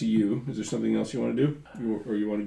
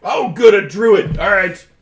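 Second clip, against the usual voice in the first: high, loud weasel voice; 7 semitones higher, 11 dB louder, slower than usual. Acted. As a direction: Gerhardt pleased to meet a druid, high and loud. GM voices Gerhardt.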